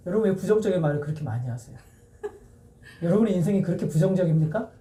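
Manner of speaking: ordinary delivery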